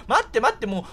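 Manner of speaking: ordinary talk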